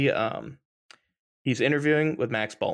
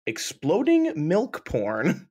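mock quote; 6 semitones higher, much slower than usual. Quoting Carlson, drawn out.

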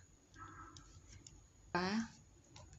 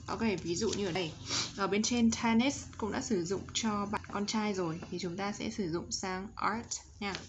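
normal talking voice

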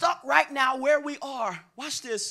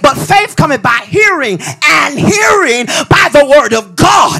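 hammered, repetitive sermon cadence; full-force preaching; 11 dB louder than usual. Real, not acted. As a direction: build each repeat toward a shouted question.

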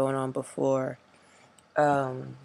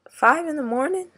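incredulous; 5 dB louder than usual. Real, not acted. Outraged at early shift.

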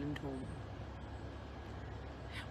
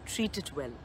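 softer, a gentle murmured echo of father; father's murmur; 4 semitones lower, 6 dB quieter than usual. Retold or imitated. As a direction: murmur it like a drowsy old father. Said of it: "I remember him murmuring".

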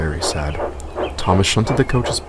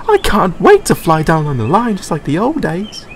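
higher, louder cheerful coach; Dexter voiced; 9 semitones higher, 3 dB louder than usual. A narrator's reading. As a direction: glad to be back, bright and eager. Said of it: "he said".

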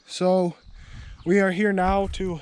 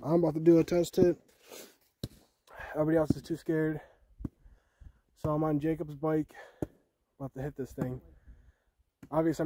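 ordinary talk

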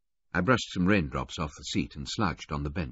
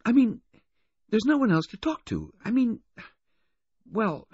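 put-on high, loud girl's voice, halting; a flustered teenager, pitched high with pauses; awkward adolescent girl; 5 dB louder than usual. Girl admitting loneliness to the waiter, awkward.

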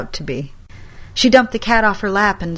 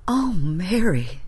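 dismayed sigh; slower than usual. A narrator's reading. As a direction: breathe it out in dismay. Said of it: "she said".

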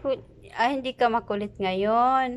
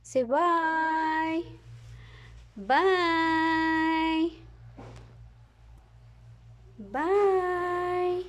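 high cheerful voice; 6 semitones higher, slower than usual. Real, not acted. High goodbye to camera.

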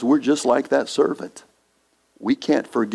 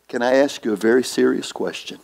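ordinary talk, nothing marked

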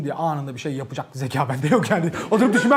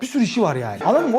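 usual speaking voice

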